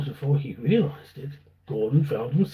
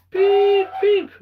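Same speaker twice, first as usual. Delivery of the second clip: high bright toot then eager words; cheerful engine beep; 8 dB louder than usual. Acted. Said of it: "said Edward".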